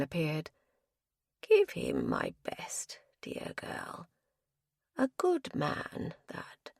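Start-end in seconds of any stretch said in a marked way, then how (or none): 1.4-6.7 whispered, slow and faint; frail whisper. Acted. Ailing husband sends his regards, faint whisper. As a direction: breathe it out like a tired old man. Weak old husband fading.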